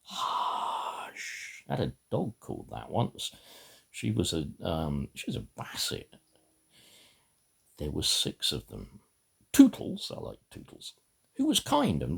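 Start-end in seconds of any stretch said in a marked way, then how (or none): 0.0-1.6 an unvoiced "Hush", breathy and hushed; whisper. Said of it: "looking as if they were saying". 10.1-10.9 whispered aside. Real, not acted. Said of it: "unvoiced aside".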